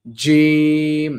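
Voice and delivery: জিম held very slowly, loud and forceful; teacher's model জিম; jim with a push. Shown as a demonstration. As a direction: stretch out a heavy pushed জিম. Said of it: "একটা ধাক্কার একটা ব্যাপার আছে".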